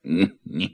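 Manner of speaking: short acted lion chuckle; storybook chuckle in separate bursts; lion's laugh. A narrator's reading. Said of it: "laughed the lion".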